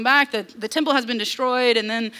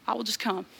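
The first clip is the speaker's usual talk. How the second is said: gentle resolve; 3 semitones lower, 7 dB quieter than usual. Acted. God's resolve, voiced.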